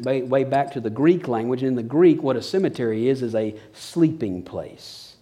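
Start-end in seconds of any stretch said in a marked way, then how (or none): 3.2-5.2 soft, drawn-out words; quiet drawn-out point; slowed emphasis. Real, not acted. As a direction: let the image sink in. Slow emphatic preaching point.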